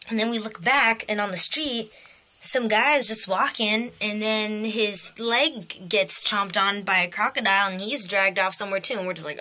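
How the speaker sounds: ordinary delivery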